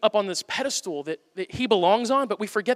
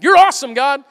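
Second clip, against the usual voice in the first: David praising; 7 semitones higher, 11 dB louder than usual. Retold or imitated. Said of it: "he's going".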